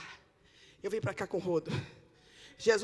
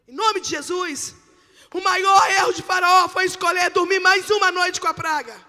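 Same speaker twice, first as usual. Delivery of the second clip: loud, high-pitched sermon shout; fiery, shouted exhortation; full-force preaching; 10 semitones higher, 16 dB louder than usual. Real, not acted. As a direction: preach it out at the top of your voice.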